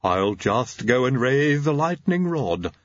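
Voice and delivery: slow, pleased lisping servant; delighted lab assistant, drawn out; Igor. A narrator's reading. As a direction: pitch it up, beaming, with a lisp. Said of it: "He beamed".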